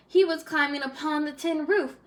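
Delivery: ordinary delivery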